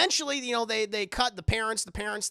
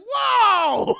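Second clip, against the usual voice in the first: much higher and louder, startled; shocked whoa; 11 dB louder than usual. Retold or imitated. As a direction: caught off guard by her child's swearing. Esther insults the nun.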